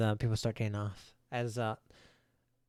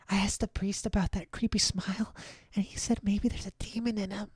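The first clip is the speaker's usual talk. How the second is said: hushed, higher whisper; 8 semitones higher, 3 dB quieter than usual. Acted. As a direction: lean in and whisper it. GM whispering an NPC aside.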